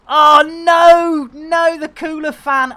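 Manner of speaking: loud, high wail of dismay; big, drawn-out groan of sympathy; dismayed cry. Real, not acted. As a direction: cry out at terrible news. Dismay at broken cooler fan.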